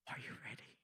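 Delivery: breathy secret whisper; soft whisper to the fruit; whisper to a melon. Retold or imitated. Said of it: "you lean in very close".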